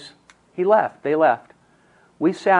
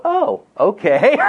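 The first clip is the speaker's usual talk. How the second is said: high, slow, laughing mock "oh, okay"; breezy sing-song send-up, ending in a laugh; laughing parody of a relaxed dispatcher; mock casual dispatcher; 4 dB louder, slower than usual. Mocking how 911 would respond.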